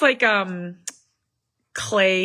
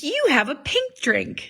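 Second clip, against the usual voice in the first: put-on higher, childlike voice; imitating little daughter; 6 semitones higher than usual. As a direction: say it like a curious toddler. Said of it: "She's like".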